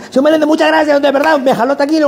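put-on high, rushed, overeager young salesman; eager salesman. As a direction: pitch the shared taxi like a pushy go-getter. Student thanks narrator.

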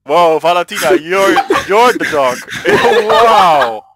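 laughing. Real, not acted. Laughing at dog impression.

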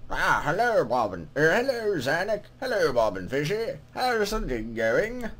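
put-on lilting greeting from an old-world scribe; put-on scribe voice, swooping in pitch; scribe's character voice. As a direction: voice a game scribe saying hello. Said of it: "what voice should I give him".

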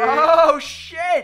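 high, loud outburst; sudden high-pitched exclamation; startled yell. Real, not acted. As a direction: yell it out in alarm at the game. Startled outburst during game play.

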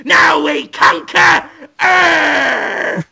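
high, loud, gloating villain cry, the last part slow; triumphant villain shout. Acted. The freed villain gloating to Brendan Fraser, screaming in triumph. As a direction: roar in evil triumph at being freed. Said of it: "he says".

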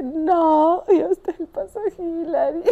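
high, wailed disbelief ending in laughter; comic dismay. Retold or imitated. Climber realising this is the Hillary step, comic dismayed wail.

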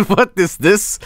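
slow, giggling disbelief; amused, incredulous; laughing. Real, not acted. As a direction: laugh through the question. Laughing at fan art.

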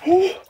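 shocked yelp. Real, not acted. Jumping into ice water.

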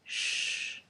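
"Sh" as a soft hiss, teeth apart; sh sound. Shown as a demonstration. Teacher showing sh, not ch. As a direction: hush like asking for silence. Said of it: "un sonido como de silencio".

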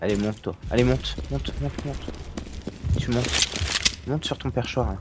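gentle coaxing voice, hushed; coaxing softly. Real, not acted. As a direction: soothe a pet onto its perch. Coaxing a pet onto its perch.

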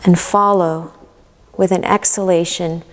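calm guided meditation with long pauses. Acted. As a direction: guide them gently into relaxation, leaving room to breathe.

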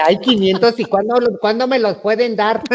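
excited retold question; eager excitement. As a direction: ask for the money in a rush of excitement.

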